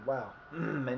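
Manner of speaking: ordinary delivery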